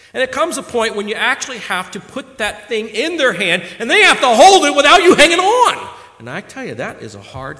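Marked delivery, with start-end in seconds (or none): 0.1-6.0 loud, high, ringing exhortation; full-force preaching. Acted. Preacher exhorting young men and elders, full-force preaching. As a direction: drive it home to the congregation.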